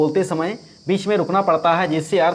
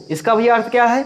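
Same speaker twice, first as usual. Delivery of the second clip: normal talking voice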